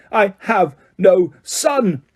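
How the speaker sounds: father disowning his son; furious. A narrator's reading. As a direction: spit out each word slowly. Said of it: "bellowed Mister Crouch".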